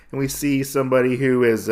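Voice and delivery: usual speaking voice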